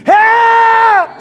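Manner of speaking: high, long holler for help; piercingly high cry for help; loud scream. Acted. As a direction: scream it out, stretching the word. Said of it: "I don't need to scream so loud".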